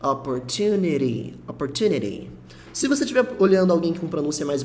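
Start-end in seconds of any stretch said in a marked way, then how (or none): 0.0-2.3 Opportunity with the last T tapped like the r in cara, opportuniri; flapped final T. Shown as a demonstration. Teacher shows the American sound to students, light tapped T. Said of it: "como se fosse o R da palavra cara".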